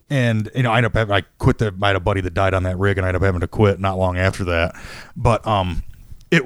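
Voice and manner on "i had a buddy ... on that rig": ordinary delivery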